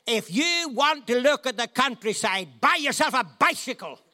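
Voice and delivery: high, loud sarcastic yell; road rage scream. Retold or imitated. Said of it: "And I screamed at him".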